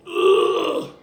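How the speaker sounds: a high, strained groan of a man coming to; imitated groan. The slumped man stirring under chest compressions, strained groan. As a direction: grunt like someone half-revived. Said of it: "the guy goes".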